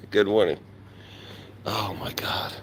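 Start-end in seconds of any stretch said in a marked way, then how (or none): 1.7-2.5 breathy whispered aside; whispering. Real, not acted. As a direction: whisper it under your breath.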